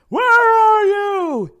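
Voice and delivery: long, high, loud call; high drawn-out call. Pretend. Calling out in the game.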